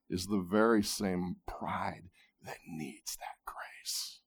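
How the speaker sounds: hushed, intimate sermon whisper. Acted. Preacher whispering to the congregation about pride needing grace. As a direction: lean in and whisper it.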